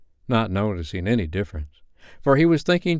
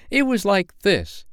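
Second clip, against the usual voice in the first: put-on confident child demonstrating; 12 semitones higher, 3 dB louder than usual. A narrator's reading. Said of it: "she did it ever so much better".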